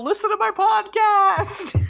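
high, slow, hammed-up yell; mock yell. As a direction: yell it out as a joke plug. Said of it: "did you yell".

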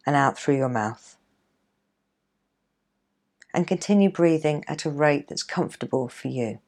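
guided meditation. Acted. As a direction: ease them into calm, gently. Leads a guided relaxation.

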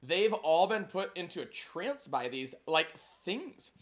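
put-on a light Valley Girl voice with lots of like. Time travelers chatting in a Valley Girl accent. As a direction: play it ditzy and breezy, Valley Girl. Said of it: "What about Valley Girl?".

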